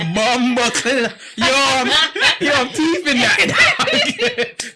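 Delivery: high, drawn-out laughing exclamation; hammed-up amazed reaction; excited disbelief. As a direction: retell it cracking up, stretched and high. Retold delighted reaction to slang.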